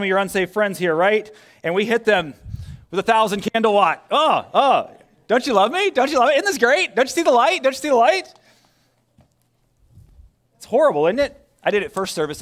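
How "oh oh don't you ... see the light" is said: high, loud, rushed mock enthusiasm; overeager pleading. Church pushing its light on unsaved friends, overeager. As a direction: pile on needy questions, pitched way up. Acts out overbearing Christians dazzling friends.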